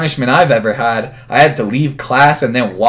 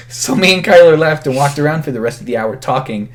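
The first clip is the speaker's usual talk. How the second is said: ordinary talk, nothing marked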